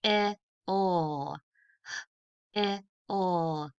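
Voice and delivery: I, o given as separate tapped sounds. Shown as a demonstration. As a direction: tap out each sound of hill. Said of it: "Let's tap it out".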